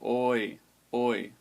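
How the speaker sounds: "oi" held long; long diphthong. Shown as a demonstration. Teacher showing that oi can be long, drawn out. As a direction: stretch out "oi" as a long glide. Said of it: "it can also be long".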